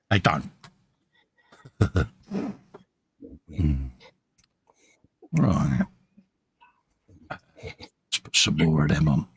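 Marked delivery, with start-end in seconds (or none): none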